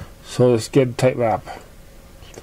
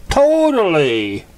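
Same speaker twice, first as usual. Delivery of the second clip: high, loud and slow emphatic cheer; excited; 6 semitones higher, 4 dB louder, slower than usual. A narrator's reading. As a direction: stretch it out with delight. Rainbow Dash enthusing.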